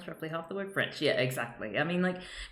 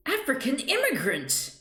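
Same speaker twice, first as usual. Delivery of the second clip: mocking read; 9 semitones higher, 4 dB louder than usual. Reading tweet in mock outrage.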